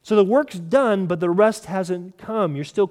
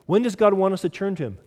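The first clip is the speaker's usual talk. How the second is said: normal talking voice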